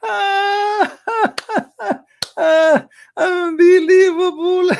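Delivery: high cackling devil laugh; Shaitan's laugh. Acted. Shaitan laughing at a yawning believer, high cackle. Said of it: "he laugh".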